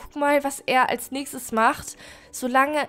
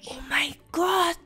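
awed hiding whisper; slower than usual. Real, not acted. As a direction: breathe it out in a whisper. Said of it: "jetzt müssen wir echt leise sein". (ehrfürchtiges Flüstern)

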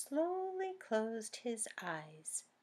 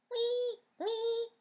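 high, louder piggy squeal; piglet squeal; 5 dB louder than usual. Acted. Piglets squealing at the children, high. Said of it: "squealed the piglets".

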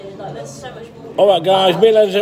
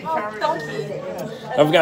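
whispered; 2 semitones higher, 5 dB quieter, much slower than usual. Real, not acted. Whispered aside.

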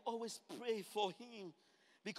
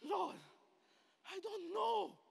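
Paul answering; 9 semitones higher, slower than usual. Acted. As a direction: answer small and high, overwhelmed. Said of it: "And Paul said".

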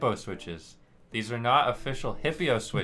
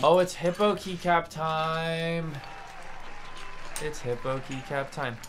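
excited announcement; 5 semitones higher than usual. Pretend. Announcing hippo keycap time.

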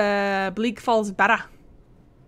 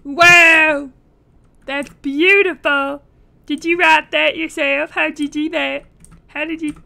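louder, higher fake gushing; mock awe; 4 semitones higher, 8 dB louder than usual. Streamer mocking a drunk character, fake awe. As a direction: praise him sarcastically.

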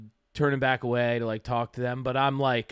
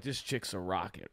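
low, soft inner voice, talking to himself; thinking it privately under his breath; inner thought voice; 8 semitones lower, 14 dB quieter than usual. Retold or imitated. Retelling what he thought at the juice bar, low and soft. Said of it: "This is also going on in my mind".

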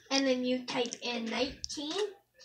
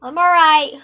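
loud call, building louder each time; shouting; 4 semitones higher, 16 dB louder than usual. Real, not acted. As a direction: yell it out, getting louder. Calling out repeatedly.

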